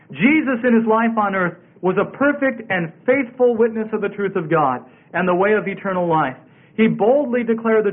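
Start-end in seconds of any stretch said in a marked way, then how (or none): none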